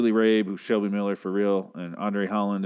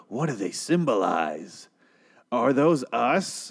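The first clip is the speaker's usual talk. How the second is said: slow, put-on mocking tone; mocking quote; slower than usual. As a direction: drag it out to make her sound silly. Mocking the assistant's reaction.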